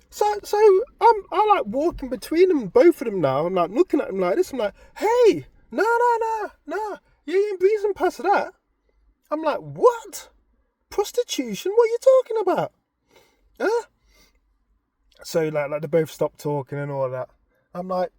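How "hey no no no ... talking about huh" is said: high, loud indignant no no no; indignant confrontation. Retold or imitated. Husband stepping between wife and friend, high loud indignant. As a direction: block them, demanding, higher and louder.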